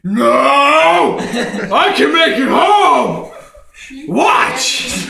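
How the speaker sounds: loud laughing protest; defiant shout. Retold or imitated. Husband to wife, loud defiant laughing protest. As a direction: insist loudly you'll make it. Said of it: "I'm like".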